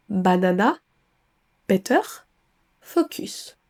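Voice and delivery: "banana, better, focus" with every written vowel sounded fully; English words read as if French, no schwa; French spelling pronunciation. Shown as a demonstration. Teacher shows French learners' mistake, French-style vowels. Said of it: "tendance à remplacer le son schwa".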